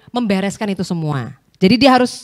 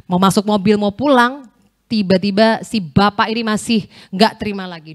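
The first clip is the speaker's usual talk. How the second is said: usual speaking voice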